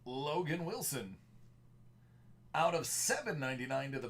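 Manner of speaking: big movie-trailer announcer read; projected movie voice. Acted. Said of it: "projecting the movie voice".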